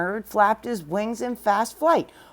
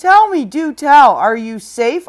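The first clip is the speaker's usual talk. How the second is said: joyful cry; 3 semitones higher, 8 dB louder than usual. Acted. Horton finding his friends at last, loud joyful cry. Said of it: "cried the elephant".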